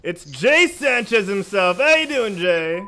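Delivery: big booming host intro; announcer hype. Acted. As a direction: hype up the guest like a wrestling announcer. Hyped guest introduction.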